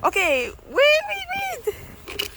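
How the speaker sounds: rising panicky wait wait; scared laughing pleas; panicked. Real, not acted.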